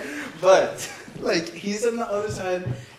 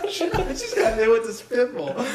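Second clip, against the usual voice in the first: high, loud laughing retell; 6 semitones higher, 5 dB louder than usual. Real, not acted. Friend repeating the teacher's spitball line, laughing. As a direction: crack up on the punchline. Repeats punchline while cracking up.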